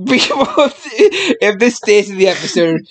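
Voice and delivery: slow, laughter-broken words; laughing while talking. Real, not acted. Podcaster warning about the episode, laughing while talking. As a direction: fight through giggles to finish.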